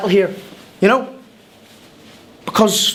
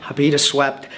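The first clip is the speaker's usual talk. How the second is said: ordinary talk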